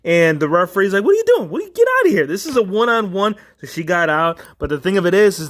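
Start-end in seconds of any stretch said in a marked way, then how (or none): 1.0-3.4 high-pitched mock referee telling off an intruder; indignant referee. Referee ordering someone out of the ring, indignant. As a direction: act out a startled ref, voice jumping high. Acting out the referee ejecting her.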